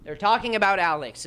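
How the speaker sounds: ordinary delivery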